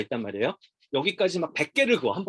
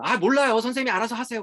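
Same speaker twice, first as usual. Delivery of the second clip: high-pitched, louder irritated dodge; acted exasperated refusal, pitched way up; dismissive brush-off; 9 semitones higher, 4 dB louder than usual. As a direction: brush the teacher off before hanging up. Acted parent brushing off teacher's call.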